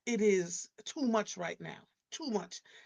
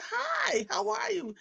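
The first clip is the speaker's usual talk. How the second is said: mock cheerful greeting; 13 semitones higher, 3 dB louder, slower than usual. Her own greeting, retold.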